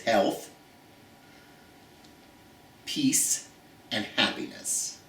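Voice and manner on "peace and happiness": soft. Real, not acted. Heartfelt wish to viewers.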